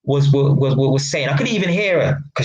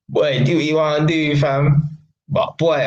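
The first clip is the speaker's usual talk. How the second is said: ordinary delivery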